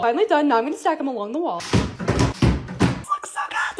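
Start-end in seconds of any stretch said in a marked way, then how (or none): 1.6-3.8 high, slow breathless squeal of joy; overjoyed gasping squeal; happy squeal. Real, not acted. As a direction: squeal it out, thrilled. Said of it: "Sorry, I'm just so happy".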